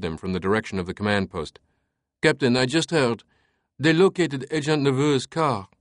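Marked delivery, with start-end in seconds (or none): 2.2-5.7 put-on higher-pitched French-accented subordinate; eager lieutenant. A narrator's reading. Lieutenant reporting the found car to his captain, eager.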